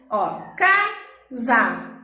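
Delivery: casa split slowly, pause after ca; pause between syllables. Shown as a demonstration. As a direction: stop briefly between ca and sa. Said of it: "Eu dou uma pausa pra falar a outra parte". (casa devagar, com pausa entre as sílabas)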